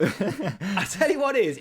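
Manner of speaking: laughing through words. Real, not acted. Pitching a Northeast soap idea, laughing through it. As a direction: chuckle it out.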